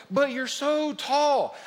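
lightly voiced amazed stranger; mock amazed asker. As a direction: mimic people gaping up at him. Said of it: "this is what they".

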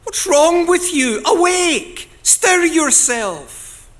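loud, high, desperate cry to wake God; shouting at God. Acted. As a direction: shout it up at heaven, demanding. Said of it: "he says to God".

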